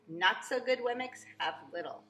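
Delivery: ordinary delivery, nothing marked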